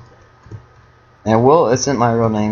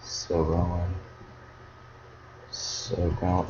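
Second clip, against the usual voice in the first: soft muttering to yourself; hushed, confident murmur; quiet self-assurance; 3 semitones lower, 9 dB quieter than usual. Real, not acted. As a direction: say it under your breath, absorbed in play. Muttering confidence while playing.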